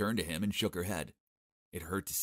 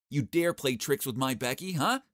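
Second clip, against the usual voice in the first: angry threat; 6 semitones higher, 6 dB louder than usual. Acted. Grabs collar, threatening.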